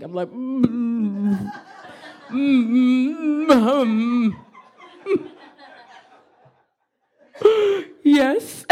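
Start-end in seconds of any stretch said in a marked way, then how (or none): 0.3-7.8 drawn-out closed-mouth moans, feigning injury; hammed-up wordless humming groans; faked mute moaning. Patient pretending to the nurse they can't speak, faked moaning. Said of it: "trying to act like I can't speak". 8.0-8.6 high-pitched, hammed-up feeble answer; milk it like a faking patient; mock dramatic. Patient faking hurt for the nurse, mock dramatic. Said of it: "just real dramatic".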